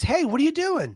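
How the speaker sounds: high-pitched puzzled hail; acted calling down. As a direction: call out a curious question. Passing soldier calls into hole.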